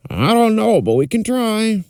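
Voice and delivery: put-on stable boy voice. Other stable boy answers.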